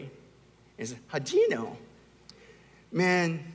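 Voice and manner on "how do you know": mimicking a toddler's challenge; child with attitude. Son challenging dad over a strawberry, with attitude. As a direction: question it like a sassy little kid. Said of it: "he says it with an attitude".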